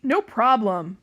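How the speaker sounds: loud monster reply; booming monster. A narrator's reading. Sea monster answering the captain, lightly voiced boom. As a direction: boom it out like a friendly sea beast. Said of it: "booms the monster".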